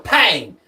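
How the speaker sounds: sharp, loud mock gunshot. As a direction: punch it like a shot. Hammer drops, gun fires.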